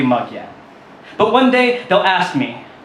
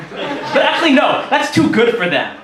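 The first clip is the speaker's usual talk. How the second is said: laughing; 6 semitones higher, slower than usual. Real, not acted. Laughing through spoken word piece.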